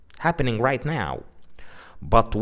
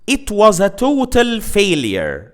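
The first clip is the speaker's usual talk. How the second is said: higher and louder, "total" stressed with a rise; emphatic example; 7 semitones higher, 4 dB louder than usual. Shown as a demonstration. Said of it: "Another example".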